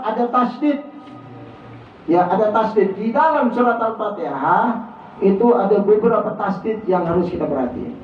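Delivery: ordinary talk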